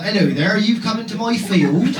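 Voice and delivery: slow Dorset farmer seduction; Dorset accent. Acted. Farmer in an unlikely sexual fantasy, thick Dorset accent. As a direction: play a would-be seductive farmer in broad Dorset. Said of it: "nobody's got a sexual fantasy where it's like".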